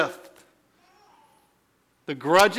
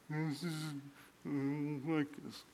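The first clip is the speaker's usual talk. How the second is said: whiny; 16 dB quieter than usual. Retold or imitated. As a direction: grumble it like a sulky child. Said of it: "These whines".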